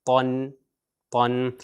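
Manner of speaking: طن said as "tun" with a heavy t; طن pronounced "tun"; tanween sound. Shown as a demonstration. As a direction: say طن as "tun", firm t. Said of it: "تو اس کا تلفظ ہوگا".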